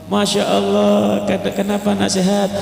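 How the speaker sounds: high, lilting delight; sweet sing-song fondness; smiling greeting to a child. Acted. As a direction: coo a stretched-out hello to a little child. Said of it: "Ketemu sama anak kecil senyum".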